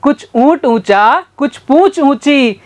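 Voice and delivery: careful deliberate tongue twister; slow tongue twister. Shown as a demonstration. Teacher slowly demonstrates the camel tongue twister. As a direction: lay out each word slowly. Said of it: "वापस से बोलना मेरे साथ".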